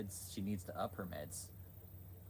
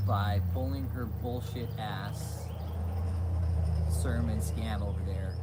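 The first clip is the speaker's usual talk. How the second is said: louder, very slow and weighted; slow emphasis; 8 dB louder, slower than usual. Real, not acted. Vlogger condemning her sermon, slow loud emphasis. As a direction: drag each word out loudly.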